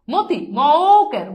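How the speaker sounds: মতি stretched out very slowly, syllable by syllable; slow syllable demo. Shown as a demonstration. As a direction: drag out মতি for the class. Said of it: "উচ্চারণটা কিন্তু মতি".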